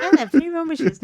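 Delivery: playful high-pitched character quote; Rachel. Acted. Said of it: "the way she says it".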